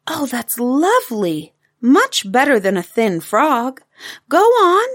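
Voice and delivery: high, eager praise, rising to a loud urging; thrilled little girl, building to a high call; delighted child. Acted. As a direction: gush with delight, then urge him on. Taffy delighted, urging Daddy to continue.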